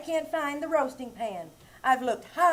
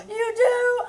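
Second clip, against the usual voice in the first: higher, thrilled surprise; higher delighted question; delighted gasp; 8 semitones higher than usual. Acted. Gasps in delight.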